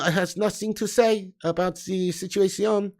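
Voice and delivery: put-on slow, lightly Portuguese-accented line; a hint of Mourinho dodging questions. Reading a chat joke as Mourinho, slow mild accent. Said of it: "automatically sounds like Josie".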